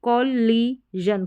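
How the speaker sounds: कॉलिजन in slow parts, kol-lee-zhun; syllable-by-syllable कॉलिजन. Shown as a demonstration. Teacher showing how to break it, कॉलिजन in slow syllables. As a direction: pause between the syllables of कॉलिजन. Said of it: "बड़ी spellings को किस तरीके से break".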